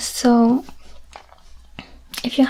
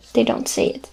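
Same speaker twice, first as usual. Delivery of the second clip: ordinary delivery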